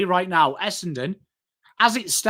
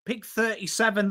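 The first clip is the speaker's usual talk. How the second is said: ordinary delivery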